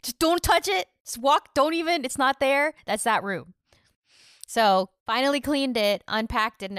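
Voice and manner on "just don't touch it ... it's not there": raised, hurried don't-touch warning; urgent warning to guests. Retold or imitated. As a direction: shoo people from the room. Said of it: "you're like".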